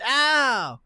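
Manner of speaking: high, loud mock "ow"; acted pain yelp. Game master reacts for the bitten dwarf, acted yelp. Acts out character taking damage.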